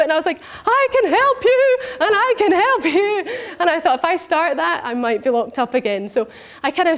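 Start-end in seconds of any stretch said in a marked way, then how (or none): 0.7-3.2 much higher, slow and laughing; eager excitement. Retold or imitated. Woman retelling how she wanted to help her colleagues, laughing. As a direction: burst with eagerness to help everyone.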